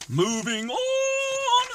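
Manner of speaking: very high, drawn-out call; mock-grand high-pitched transition; playful announcement. Teacher calls out a transition.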